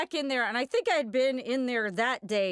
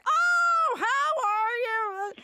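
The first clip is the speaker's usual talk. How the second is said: very high, loud, gushing greeting; exaggerated delighted caricature on the phone; Frank; 13 semitones higher, 4 dB louder, slower than usual. Frank greeting Michelle on the phone, hammed-up high voice.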